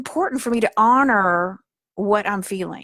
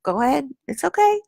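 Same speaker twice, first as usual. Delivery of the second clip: high, gentle coaxing to your inner self; tender, higher reassuring voice; soothing reassurance; 5 semitones higher than usual. Shown as a demonstration. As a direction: soothe a frightened child part. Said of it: "You say".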